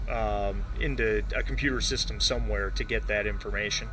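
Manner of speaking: normal talking voice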